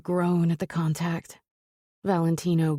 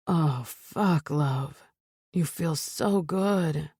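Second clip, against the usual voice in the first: groaning; much slower than usual. Acted. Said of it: "he groans".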